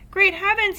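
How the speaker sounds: high, loud excited exclamation; excited cry. A narrator's reading. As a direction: burst out in amazement. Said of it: "he cried".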